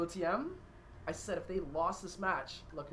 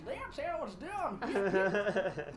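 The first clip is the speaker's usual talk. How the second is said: much higher, very slow cartoon hero voice; Gyro voice; 9 semitones higher, much slower than usual. Caster casts the match as Gyro, high and very slow. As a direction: drawl it out high like a cocky character. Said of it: "Gyro voice".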